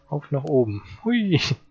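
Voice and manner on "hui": excited whoop. Real, not acted. Jumps down in the game.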